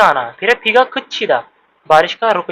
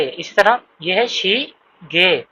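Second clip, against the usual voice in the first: usual speaking voice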